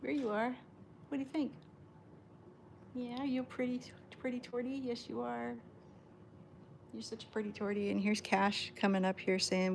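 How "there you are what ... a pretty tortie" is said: higher, much softer adoring coo. Real, not acted. Owner crooning to Tawny that she's a pretty tortie, soft high coo. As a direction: croon praise to the pretty cat.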